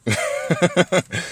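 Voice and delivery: a short chuckle at one's own joke. Real, not acted.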